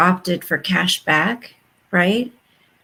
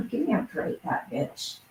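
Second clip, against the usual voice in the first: scornful mutter; 3 semitones higher, 12 dB quieter than usual. Real, not acted.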